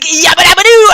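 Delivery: high, loud crazed cheer; wild whoop. Retold or imitated. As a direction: let it rip like a maniac. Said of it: "she's like".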